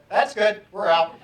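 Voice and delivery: a hammed-up we're out; teachers bailing out. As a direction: teachers ducking out of the room, joking. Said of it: "all the veteran teachers went".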